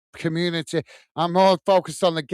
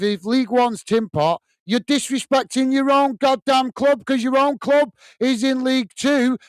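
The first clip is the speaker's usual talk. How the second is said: higher, rising heat, really telling him off; heated rant; 6 semitones higher than usual. Real, not acted. Scolding a commenter for mocking League One, heated rant.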